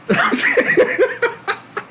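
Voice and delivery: high, wheezy laughing fit. Real, not acted. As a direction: crack up at your own scream. Cracks up at own scream.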